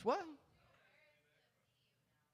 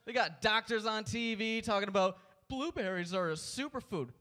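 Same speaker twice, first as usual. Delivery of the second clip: usual speaking voice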